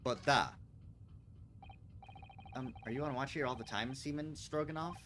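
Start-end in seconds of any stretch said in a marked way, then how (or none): 0.0-0.5 curt Russian refusal. Acted. Sailor refuses.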